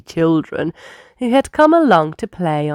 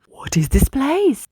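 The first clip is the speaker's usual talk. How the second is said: higher, curious question; wondering; 8 semitones higher than usual. A narrator's reading. Bus arriving at strange garage doors, curious and higher. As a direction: ask in wide-eyed wonder. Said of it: "wondered J J".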